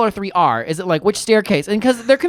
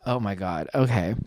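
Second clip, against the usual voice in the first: low, very soft reaction; muttered aside; 10 semitones lower, 9 dB quieter than usual. Retold or imitated. Bystander overhearing the quarrel, low muttered aside. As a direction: mutter it under your breath. Teller's own awkward reaction.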